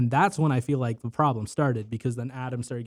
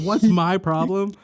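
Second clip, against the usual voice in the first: loud, higher, slow mock challenge; manic retort; 7 semitones higher, 8 dB louder, slower than usual. Retelling the assistant's comeback to his coworker, mock-manic and loud. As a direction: imitate an unhinged boss throwing the question back. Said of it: "fucking maniacal laugh".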